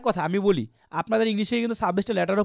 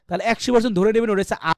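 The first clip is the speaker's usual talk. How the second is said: ordinary talk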